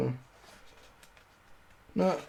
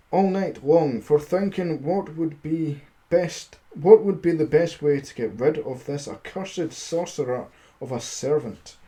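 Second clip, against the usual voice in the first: steady storybook reading voice; story narration; 2 semitones higher, 4 dB louder than usual. A narrator's reading. Streamer reading a fairy tale to his chat, storybook narration. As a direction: read the fairy tale aloud to listeners.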